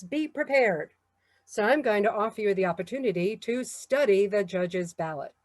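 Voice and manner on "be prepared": scout motto. Retold or imitated. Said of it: "Scout says".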